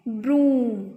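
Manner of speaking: "ब्रूम" loud, with a held oo, falling; teacher confirming "ब्रूम" with long oo; long OO sound. Shown as a demonstration. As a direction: stretch the vowel in "ब्रूम". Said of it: "बड़े ऊ की ऊम".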